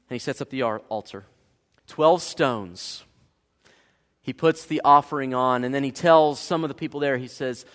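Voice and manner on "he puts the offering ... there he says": normal talking voice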